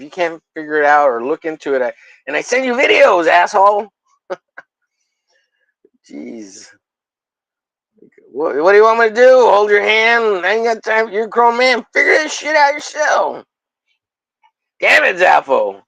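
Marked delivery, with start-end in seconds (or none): 2.3-3.9 raised, higher mock scolding; fake-angry call-out at a friend; mock rant. 8.3-15.8 hammed-up scolding of a grown man; mock exasperated rant.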